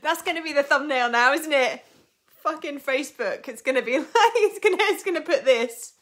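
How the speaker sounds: high, loud, laughing rant; excited mock outrage. Real, not acted. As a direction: rant in giddy disbelief.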